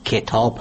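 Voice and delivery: کتاب said with kasra on the kaf, kitab, not katab; correct pronunciation. Shown as a demonstration. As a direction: say کتاب as kitab, the first vowel short i. (کتاب با کسرهٔ کاف، کِتاب)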